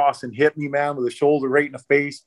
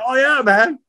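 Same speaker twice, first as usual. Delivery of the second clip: breezy reassurance; 10 semitones higher than usual. Retold or imitated. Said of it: "I'm like".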